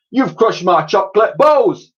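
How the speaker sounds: high loud hollered complaint; hollering vendor. Acted. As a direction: holler at the ruined sweets. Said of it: "hollered a familiar voice".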